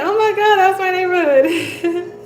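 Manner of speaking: the thrill of finding a neighbor far from home; retold surprise at a shared neighborhood; excited recognition. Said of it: "I was like".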